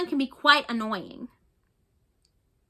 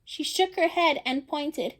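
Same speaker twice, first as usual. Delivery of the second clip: usual speaking voice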